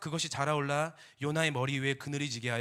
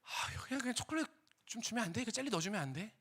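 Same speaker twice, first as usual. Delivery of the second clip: put-on higher, childish wheedling voice; whiny husband; 8 semitones higher, 3 dB quieter than usual. Husband wheedling his wife for chocolate, high and whiny. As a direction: whine like a kid asking for candy.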